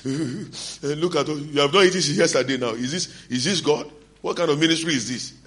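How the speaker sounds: high-pitched, wailing complaint; mock crying. Preacher retelling his crying to his wife about hunger, mock crying. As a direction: whine and wail through the retold grievance. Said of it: "And I will be crying".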